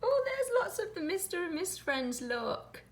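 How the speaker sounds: ordinary delivery, nothing marked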